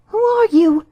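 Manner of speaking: high, loud frightened cry; startled out of sleep in fright. A narrator's reading. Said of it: "starting up in a great fright".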